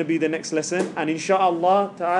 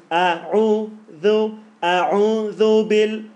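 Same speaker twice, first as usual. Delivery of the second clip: slow chanted "A'udhu"; Quranic recitation; 4 semitones higher, 5 dB louder, much slower than usual. Shown as a demonstration. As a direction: stretch out each syllable of "A'udhu". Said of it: "So it's".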